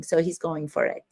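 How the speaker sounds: usual speaking voice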